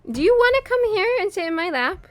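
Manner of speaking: high, loud sing-song coaxing to a cat. Real, not acted. Owner coaxing her cat into her lap, high pet voice. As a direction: call the cat up onto your lap, way up high.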